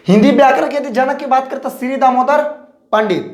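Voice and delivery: normal talking voice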